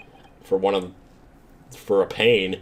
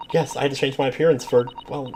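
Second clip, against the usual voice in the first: usual speaking voice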